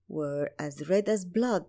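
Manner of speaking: ordinary delivery, nothing marked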